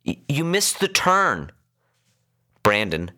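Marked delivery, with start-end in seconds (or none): 0.1-1.5 high-pitched, stammered alert; overloud. A narrator's reading. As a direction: point it out insistently to the driver. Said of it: "she spoke overloud".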